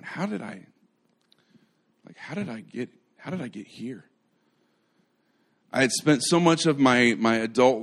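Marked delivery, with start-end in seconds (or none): 0.0-4.0 soft, bewildered self-questioning; hushed retold inner voice, faltering and restarting; wondering like Peter. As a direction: murmur it to yourself in disbelief. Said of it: "I was a little like Peter".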